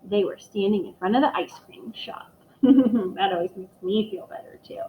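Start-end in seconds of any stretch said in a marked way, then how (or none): none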